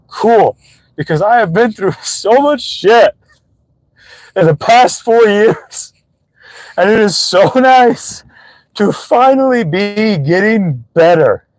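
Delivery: slow down as real emotion rises; emotional. Real, not acted. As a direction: voice lifted, moved by how far you've come. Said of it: "kind of emotional right there".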